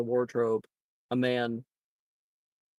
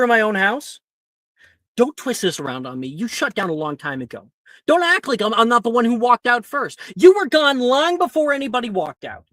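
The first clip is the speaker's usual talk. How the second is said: loud, heated accusations; angry argument; 6 semitones higher, 8 dB louder than usual. Acted. Boggart parents screaming at each other, heated quarrel. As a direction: fight bitterly with your spouse.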